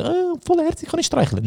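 high, sugary gushing over a puppy; gushing stranger. Retold or imitated. Stranger gushing over the dog, in a high mock squeal. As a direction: squeal like a stranger who wants to pet the dog. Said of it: "alle drei Minuten".

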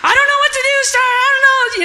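high, loud mock wail of panic; hammed-up high panic, half crying; imitated panicking sister. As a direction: play the frantic sister who can't cope. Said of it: "she's over here crying".

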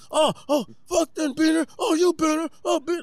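high yelled slurs in a fight; hammy imitation of a brawler yelling; mock fighter yelling.

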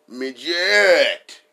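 midget shouted loud and falling; shouted punchline. Acted. Punchline after the rest of us.

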